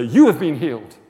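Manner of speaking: loud demonstration proclamation; booming mock healing pronouncement; over-bold declaration. Shown as a demonstration. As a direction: proclaim it far too forcefully.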